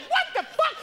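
high-pitched indignant outburst; retold shock and anger, pitch leaping up; outraged. Man seeing a kid writing on his wall, high-pitched outrage.

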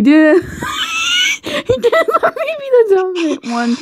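high squealing laugh through the words; laughing shout. Real, not acted. Reader loses it at the smut, high laughing squeal. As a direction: shriek with laughter while reading. Cracks up reading the line.

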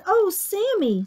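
high, exasperated mom voice; sighing mother. Acted. Mother scolds her shark son for biting, sighing. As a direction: sigh it in mild dismay. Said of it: "sighed his mother".

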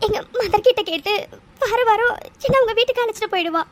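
speaking through tears, voice catching; tearful confession. Acted. Orphan telling a loved one her past, crying. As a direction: let the tears come as you confess the truth. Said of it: "அழாத".

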